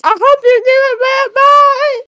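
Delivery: high shouted farewell; cheery shout. Acted. Astrid yelling goodbye before Billy hangs up, high and loud. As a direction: yell goodbye brightly at the phone. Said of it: "Astrid just goes".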